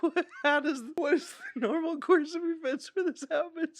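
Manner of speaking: high, squeaky voice through laughter; helplessly laughing, pitch shooting up; real incredulous laughter. Real, not acted. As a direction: crack up while asking in disbelief. Cracking up at the book.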